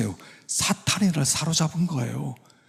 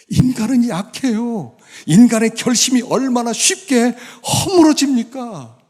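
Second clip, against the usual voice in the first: impassioned preaching; 10 semitones higher, 5 dB louder than usual. Real, not acted. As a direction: exhort with raised, emphatic voice. Preaches with raised voice.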